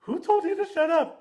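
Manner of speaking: very high, loud retold protest; incredulous yell. Son answering mom's accusation, incredulous mock yell. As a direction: squawk the question back in mock disbelief.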